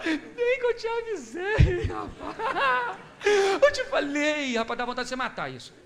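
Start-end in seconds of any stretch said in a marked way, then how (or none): 0.0-4.8 high, slow, mocking whine through a laugh; a smug friend gloating over your bad luck; gloating told-you-so. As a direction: sneer it like you knew all along. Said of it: "ele fala".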